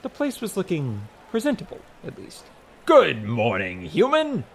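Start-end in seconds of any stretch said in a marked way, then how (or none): 2.9-4.4 booming god. A narrator's reading. God of Rocks hailing the hotel boss, booming. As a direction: boom out a larger-than-life greeting. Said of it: "The booming voice".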